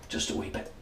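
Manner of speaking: breathy mock British whisper; whispered British aside. Reviewer mutters a joke echo in whispered British. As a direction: whisper "wee bit" in a silly British accent. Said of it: "my pathetic British accent".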